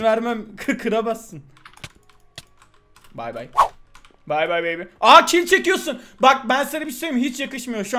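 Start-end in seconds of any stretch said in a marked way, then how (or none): 3.1-4.8 taunting goodbye. Real, not acted. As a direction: wave him off smugly. Taunting dying opponent.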